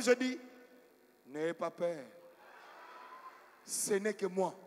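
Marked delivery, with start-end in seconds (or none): 1.3-4.5 low, measured voice of a messiah; messianic voice. Retold or imitated. Preacher playing Jesus to frightened people at night, messianic. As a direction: intone it low, like Christ calming the disciples. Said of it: "j'ai pris une voix messianique".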